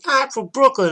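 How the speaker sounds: high, loud imitation of a drunk brawler; drunken yell. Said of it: "drunk out of her mind".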